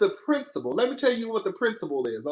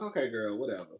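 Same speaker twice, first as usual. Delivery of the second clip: low, soft retold brush-off; dismissive aside; 12 semitones lower, 12 dB quieter, faster than usual. Customer brushing off a clerk who ignores him, dismissive. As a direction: drop it low and quiet, giving up on her.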